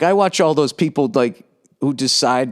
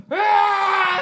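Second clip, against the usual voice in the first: high straining roar; mock lifting yell. Comic acting out lifting at home, straining roar. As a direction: roar as you heave the weight. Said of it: "So then I just go".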